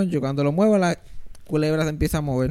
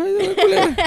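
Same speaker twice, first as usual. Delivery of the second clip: whiny mock voice; 5 dB louder than usual. Mocking himself calling mom.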